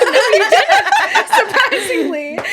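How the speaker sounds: high, loud, drawn-out laughing quote; slow words squeezed through laughter. Real, not acted. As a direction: burst out laughing on the punchline. Laughing at the nurse's answer.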